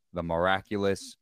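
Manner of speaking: normal talking voice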